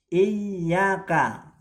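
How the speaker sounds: iyyaka with the yy held and stressed; held doubled y. Shown as a demonstration. Teacher shows students the correct iyyaka, yy held. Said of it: "bukan iyyaka tapi iyyaka".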